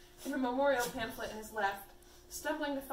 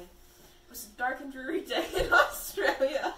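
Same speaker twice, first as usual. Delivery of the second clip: laughing while reading; 2 semitones higher, 9 dB louder, slower than usual. Real, not acted.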